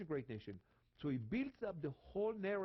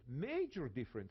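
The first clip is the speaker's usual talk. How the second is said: ordinary talk, nothing marked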